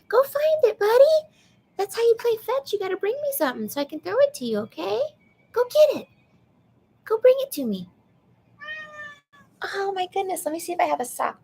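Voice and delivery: very high excited pet voice; talking to a pet. Real, not acted. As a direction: coax the cat to fetch, squeaky high. Playing fetch with cat.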